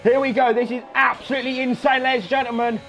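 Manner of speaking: high, hyped race call; excited play-by-play. Real, not acted. Commentator to viewers as Crocken heads down the straight, excited. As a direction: announce it like a huge moment.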